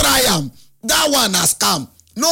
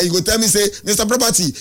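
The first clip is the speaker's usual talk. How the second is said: usual speaking voice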